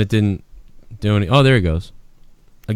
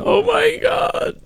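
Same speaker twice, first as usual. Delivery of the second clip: high, slow, drawn-out imitation of the arrested guy; mocking Oh my God; 3 dB quieter, much slower than usual. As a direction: stretch out a whiny, high Oh my God. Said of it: "he goes, fucking".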